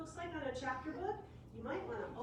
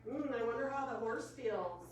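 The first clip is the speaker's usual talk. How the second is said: usual speaking voice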